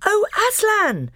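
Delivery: put-on a boy crying out on seeing the lion; joyful cry. A narrator's reading. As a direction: cry out in delight. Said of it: "he cried".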